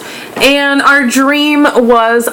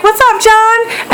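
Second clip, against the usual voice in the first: cheeky greeting; 11 semitones higher, slower than usual. Retold or imitated. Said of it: "and I was like".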